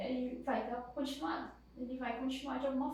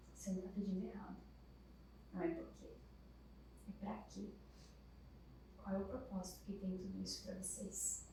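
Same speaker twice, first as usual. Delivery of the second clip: gentle; 3 semitones lower, 11 dB quieter than usual. Retold or imitated. Padre consola a mãe.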